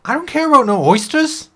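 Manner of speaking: a small shellfish who insists he was once an orc; cursed oyster. Acted. GM voicing the cursed oyster to the elf, indignant.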